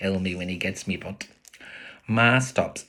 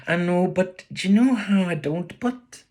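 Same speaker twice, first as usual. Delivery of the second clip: put-on young boy voice; 12 semitones higher, 5 dB louder than usual. Boy voice in story.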